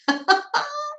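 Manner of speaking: high giggle; real laugh. Real, not acted. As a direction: laugh at yourself, pitched high. Amused she fixed nothing.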